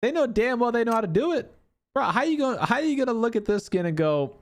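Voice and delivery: high-pitched exasperated rant; indignant complaint. Real, not acted. Streamer complaining about the skin designers, indignant and high.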